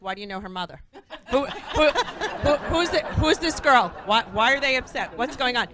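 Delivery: high, loud, rapid questions with a laugh; a suspicious wife demanding answers, half laughing; mock panicky wife; flustered wife.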